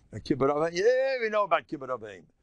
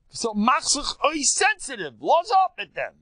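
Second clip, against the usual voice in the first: higher, louder fervent call; raised preaching voice; fervent exhortation; 10 semitones higher, 6 dB louder than usual. Real, not acted. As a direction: drive the point home loudly.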